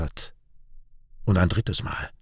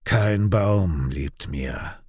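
usual speaking voice

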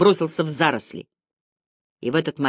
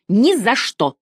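high, forceful refusal; sharp rise in pitch, firmly refusing; emphatic exclamation; 7 semitones higher, 4 dB louder, slower than usual. A narrator's reading. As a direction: exclaim it as an absolute no. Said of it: "воскликнула крошка".

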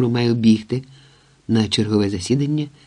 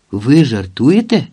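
slow, louder question with a big final rise; suspicious; 2 semitones higher, 6 dB louder, slower than usual. A narrator's reading. Vadym questions the lodger's talk of capitalism, suspicious. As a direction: question it in drawn-out disbelief. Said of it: "підозріло примружився він".

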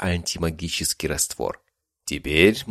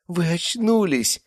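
high, slow, delighted; joyful relief at the bedside; glad nurse; 13 semitones higher, slower than usual. A narrator's reading. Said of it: "обрадовалась она". (высоко, радостно)

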